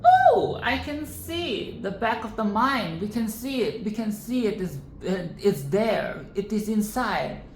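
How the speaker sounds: mock cheerful characters. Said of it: "they're like".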